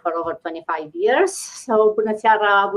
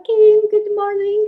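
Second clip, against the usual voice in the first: excited greeting; 12 semitones higher, 7 dB louder than usual. Real, not acted. Host greeting a guest.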